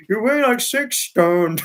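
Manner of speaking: high, loud imitation of Trevor's accent; copying David copying Trevor's accent; mock Trevor accent. Retelling David imitating Trevor's accent, high and loud. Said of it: "He tried to do Trevor's accent".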